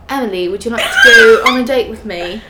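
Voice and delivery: put-on London guy asking her out; playful London accent through laughter. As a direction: do a jokey Londoner's polite invitation. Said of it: "a guy from London was like".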